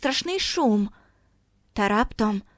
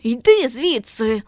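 slow, loud furious order; angry shout; 5 dB louder, slower than usual. A narrator's reading. Boy angrily ordering Margo away, loud and slow. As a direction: shout her away in rage. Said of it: "крикнув він розгнівано".

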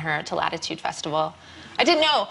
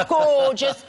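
Geordie gorgeous; 5 semitones higher, 5 dB louder than usual. Retold or imitated. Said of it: "I can't do a Geordie accent".

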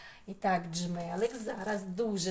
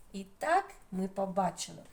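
ordinary talk

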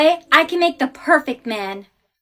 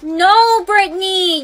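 loud, crying shout; pained no; anguished cry; 3 semitones higher, 4 dB louder, slower than usual. Acted. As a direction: cry out in dismay. Player eliminated by mistake.

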